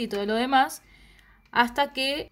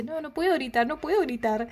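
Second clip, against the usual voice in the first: higher-pitched imitation of pleading refusal; mock-distressed, raised pitch; retold helpless protest; 6 semitones higher than usual. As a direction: plead that you can't, mildly acted. Karina protesting, voiced in retelling.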